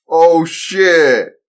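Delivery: loud, high startled cry; shocked shout. Acted. Man crying out as bazooka hits house. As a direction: yelp as the rocket hits.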